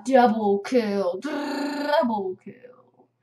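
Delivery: slow, drawn-out announcer call; mock video game announcer. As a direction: announce the kill slowly and grandly.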